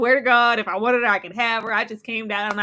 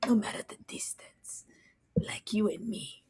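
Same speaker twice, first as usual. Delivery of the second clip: soft swooning whisper; 12 dB quieter than usual. Pretend. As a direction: murmur it like a love poem. Mock romantic whisper.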